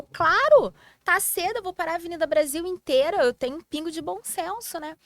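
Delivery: loud, high-pitched mock protest; indignant retort. Driver retelling her reply to the policeman, indignant. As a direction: burst out on the first word, keep it high.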